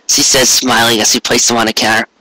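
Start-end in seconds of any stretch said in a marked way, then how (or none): none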